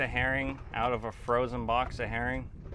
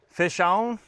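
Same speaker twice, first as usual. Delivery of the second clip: excited call; 4 semitones higher, 5 dB louder than usual. Shown as a demonstration. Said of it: "what's a good catchphrase".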